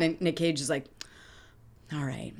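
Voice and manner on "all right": low, soft, unbothered reply; level-headed. Retold or imitated. Nick Cage's character answering a challenge to fight, level-headed. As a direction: play him cool and hushed. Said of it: "very level-headed".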